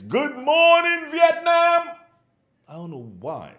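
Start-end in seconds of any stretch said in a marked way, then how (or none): 0.0-1.9 loud, high, drawn-out "Good morning, Vietnam!"; imitating nephew's shout. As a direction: belt it out like the nephew does. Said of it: "he would say it like this".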